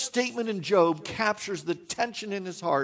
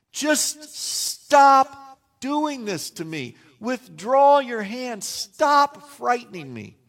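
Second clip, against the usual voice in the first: slow, higher and louder begging; anguished plea; 6 semitones higher, 7 dB louder, slower than usual. Retold or imitated. Job begging God to stop, slow and anguished. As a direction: drag out each word like a man in pain.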